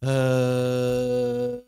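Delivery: long droning "ehh" held on a steady note; sustained "ehhh" to show vibrating vocal cords; vocal cord vibration demo. Shown as a demonstration. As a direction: hold a monotone "eh" tone. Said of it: "bergetar situ".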